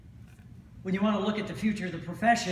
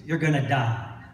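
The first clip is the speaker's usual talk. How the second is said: mock news doom; 5 semitones lower, 6 dB louder than usual. Mocking the news again.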